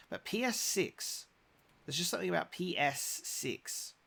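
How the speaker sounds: "P S six" said high and slow, the S of "six" stretched; drawn-out PS six. Shown as a demonstration. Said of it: "because of the, the S that I'm struggling with".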